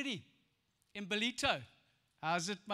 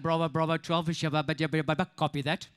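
much lower, radio-operator gabble; police radio voice; 7 semitones lower, 4 dB louder than usual. Retold or imitated. Imitating police on the radio, low gabble. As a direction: rattle it off like a cop on the radio. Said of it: "It's like".

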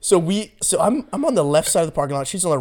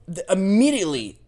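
ordinary talk

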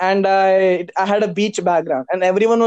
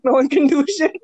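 normal talking voice

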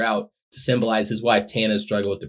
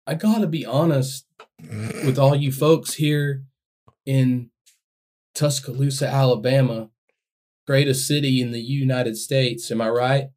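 soft-spoken wrestling promo; 4 semitones higher than usual. Pretend. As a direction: call out the crowd gently, wrestler style. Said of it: "give your best wrestling promo as [name]".

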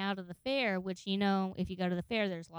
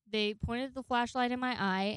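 ordinary talk, nothing marked

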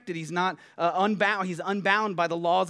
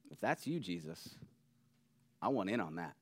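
low, very soft, wishful aside; hushed longing; 8 semitones lower, 10 dB quieter than usual. Acted. Peter seeing Jesus out on the water, quietly wishful. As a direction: say it quietly, wanting to join in.

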